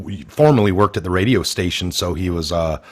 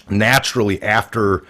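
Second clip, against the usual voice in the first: usual speaking voice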